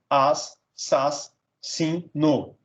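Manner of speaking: "as", "sas", "sin", "no" as separate beats; split into syllables. Shown as a demonstration. Teacher dividing assassino, "as, sas, sin, no" syllable by syllable. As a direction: break the word after each syllable, "as, sas, sin, no". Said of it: "separar um em cada sílaba".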